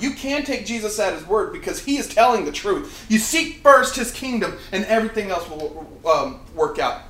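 usual speaking voice

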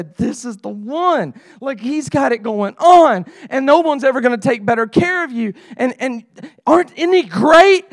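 building to a high, loud appeal; earnest hard sell. Acted. Leader trying their best to sell Jesus, excited and building. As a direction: talk him up with growing urgency. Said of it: "trying their best to say".

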